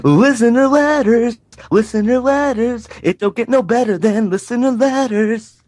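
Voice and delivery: high booming ghoul announcer; horror host. Acted. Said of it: "Dan the Ghoul Master voice again".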